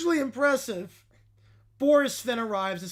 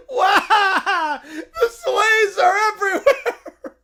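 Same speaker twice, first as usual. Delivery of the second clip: high, loud laughing yell; frantic cry; panicked shout; 7 semitones higher, 10 dB louder, much slower than usual. Acted. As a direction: holler in mock alarm. Acting panic at invasion.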